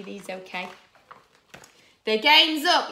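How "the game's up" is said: loud, raised-pitch challenge; heroic yell. Acted. Super Potato catching up with the pea, heroic yell. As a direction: yell it like a storybook hero. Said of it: "yelled Sue Potato".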